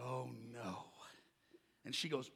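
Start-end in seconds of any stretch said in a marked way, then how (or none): 0.0-0.9 low, hushed 'uh-oh' realization; acted-out sinking horror; quiet dread. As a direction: sink into soft, sheepish dread. Realises who the customer is.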